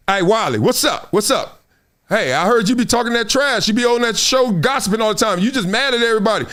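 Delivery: high-pitched, pushy confronter in a skit; trash-talking challenger. Acted. Acting out a hater walking up talking trash, pushy challenge. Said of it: "like you came up to me talking about, talking trash".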